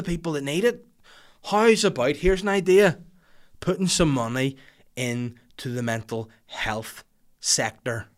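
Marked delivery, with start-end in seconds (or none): none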